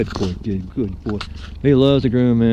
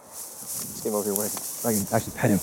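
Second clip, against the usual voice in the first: ordinary delivery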